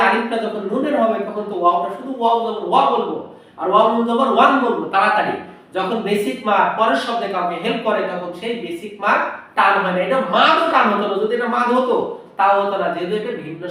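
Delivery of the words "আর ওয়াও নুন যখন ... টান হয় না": ordinary talk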